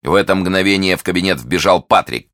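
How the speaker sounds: plain reading voice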